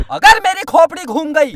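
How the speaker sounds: normal talking voice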